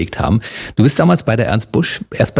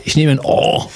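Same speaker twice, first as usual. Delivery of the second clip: Saxon contestant choosing a dark O; Saxon game-show contestant. Pretend. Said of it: "wenn der Sachse da mitgespielt hätte".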